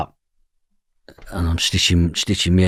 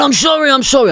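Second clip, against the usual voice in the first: very high, loud squeaky whimper; mock grovelling apology; 7 dB louder than usual. The club owner caving in and apologising, shrill mock squeal. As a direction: play him as a frightened wimp begging.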